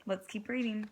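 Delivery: ordinary delivery, nothing marked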